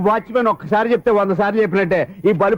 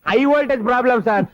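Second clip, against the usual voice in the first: loud blurt; 7 semitones higher than usual. Acted. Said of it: "ఆ మాట మెల్లగా కూడా చెప్పొచ్చాయా?".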